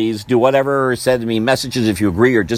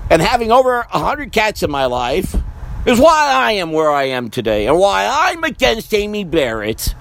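ordinary delivery

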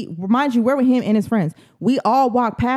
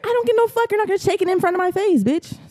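heated high call-out; 11 semitones higher, faster than usual. Acted. Defiant words aimed at the girl.

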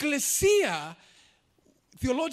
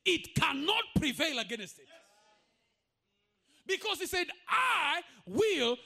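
high, slow, shouted preaching over music; full-force preaching; 5 semitones higher than usual. Acted. Preacher declaring to the congregation, high and shouting. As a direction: thunder it out to the congregation.

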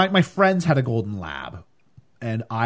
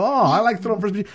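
quick, higher mock eager dad; awkward stepdad; 7 semitones higher, faster than usual. Stepdad grabbing at common ground, fast mock eager. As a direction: rush it like a clumsy dad. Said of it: "And you go".

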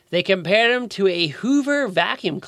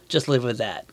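usual speaking voice

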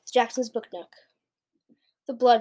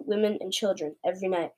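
ordinary delivery

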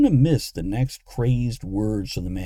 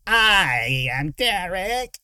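high, drawn-out creature whine; whining; 9 semitones higher, much slower than usual. A narrator's reading. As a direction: whine it, stretching every word. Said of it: "the thing in front of her whined".

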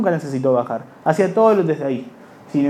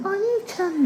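squeaky high anime-fan squeal; high-pitched cutesy anime cry; 4 dB quieter, much slower than usual. Retold or imitated. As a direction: squeal it like an otaku baby dragon. Otaku baby dragon squeal.

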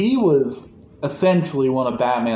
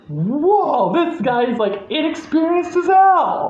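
amazed mock reaction; 13 semitones higher than usual. Fan reacting to an inexperienced Batman fleeing, high amazed exclamation. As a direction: climb higher in playful disbelief.

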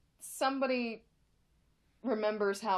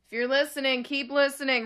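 loud; 3 semitones higher, 8 dB louder than usual. Real, not acted. Shouting to the internet.

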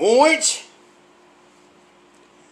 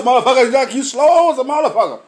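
ordinary delivery

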